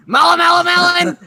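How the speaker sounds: high, loud laughing shout; mock phone greeting. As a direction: bellow it like a silly phone hello. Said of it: "pick up the phone, it's like".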